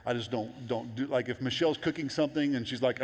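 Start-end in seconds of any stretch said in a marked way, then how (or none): none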